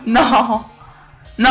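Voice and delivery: loud, high wail of disbelief; real dismayed cry. Real, not acted. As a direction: cry out as the game slips away, breaking into laughter. Dismay at losing the fight.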